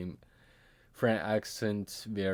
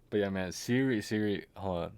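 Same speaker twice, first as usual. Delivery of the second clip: ordinary delivery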